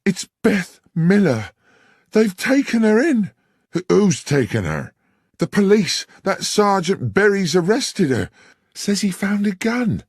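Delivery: put-on frightened young woman pouring out the news; panicked. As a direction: blurt out the bad news in alarm. Panicked woman bursts into bar.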